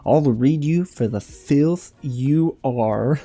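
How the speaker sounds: hammed-up diva read; fierce read. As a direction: spit each word out as a threat. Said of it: "and I quote".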